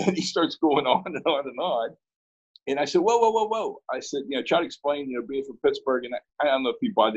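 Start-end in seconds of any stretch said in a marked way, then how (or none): 3.0-3.8 high, repeated whoas to halt someone; protesting interruption. Retold or imitated. Professor halts the caller's long tirade, protesting. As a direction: jump up high to stop the rant. Halting a caller who goes on.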